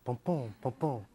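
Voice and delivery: slow pounding ba-boom, like a throbbing head; throbbing pain beat. Shown as a demonstration. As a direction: beat out the throb of a migraine, slowly. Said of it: "the pain is ba-boom".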